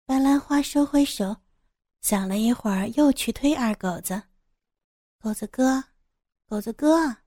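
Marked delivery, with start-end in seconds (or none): 5.2-7.2 higher female voice, coaxing; calling to wake. A narrator's reading. Woman nudging her sleeping man, calling him awake. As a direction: call him, lifting on the last word.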